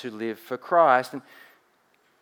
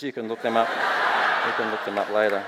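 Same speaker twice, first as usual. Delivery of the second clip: laughing aside; 3 semitones lower than usual. Real, not acted. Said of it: "that was a lie".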